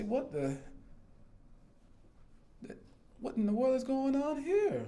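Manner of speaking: a jaw-dropping what-on-earth cry; shocked disbelief. Retold or imitated. Inmate walking into chaotic open bay, stunned disbelief.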